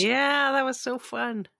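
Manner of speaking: high, gushing mock fondness; tongue-in-cheek cheerful reminiscing; mock nostalgia. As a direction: play a villain fondly recalling the war. Joking as exiled supermen reminiscing.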